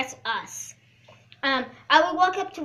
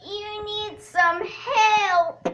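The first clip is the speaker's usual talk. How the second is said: very slow, drawn-out and higher; slow meme voice; 6 semitones higher, 6 dB louder, much slower than usual. Acted. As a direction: stretch each word as a meme. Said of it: "I have a new meme".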